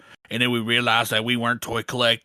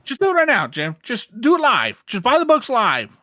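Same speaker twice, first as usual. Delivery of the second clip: shouting; 8 semitones higher, 4 dB louder than usual. Pretend. Do it live" meme.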